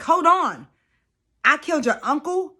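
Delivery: high, loud alarmed retelling; shocked man reacting, voiced by the vlogger; freaked-out disbelief. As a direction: act out his panic at the news. He's freaking out, retold quote.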